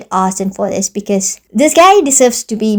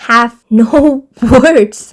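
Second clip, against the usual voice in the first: drawn out slowly through a real laugh; slow, amazed and laughing; slow laughing emphasis; 4 dB louder, much slower than usual. Real, not acted. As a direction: laugh and stretch "no words" out in disbelief.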